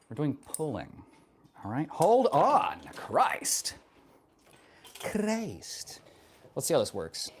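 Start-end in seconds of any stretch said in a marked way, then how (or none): 1.9-6.0 high exasperated outburst; fed up, pitched up. Real, not acted. Streamer fumbling with gear, exasperated.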